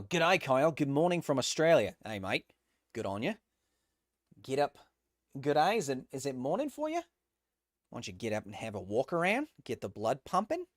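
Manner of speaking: playful put-on Australian accent. Streamer reads out a viewer's good morning from Australia, Australian accent. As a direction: greet them like a chatty Australian mate. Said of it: "when I do Australian accents".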